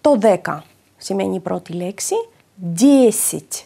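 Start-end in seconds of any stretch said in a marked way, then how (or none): 2.5-3.5 Δέσιτ with a clear, stressed "ye" and final "i"; stressed first vowel. Shown as a demonstration. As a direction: stress the first syllable of Δέσιτ, weak second as "i". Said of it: "Το γε τονίζεται και το ακούμε". (Δέσιτ με τονισμένο το πρώτο φωνήεν και άτονο «ι»)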